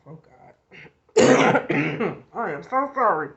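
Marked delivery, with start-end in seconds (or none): 1.2-2.3 hard loud coughing fit; real coughing fit. Real, not acted. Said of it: "I need to cough".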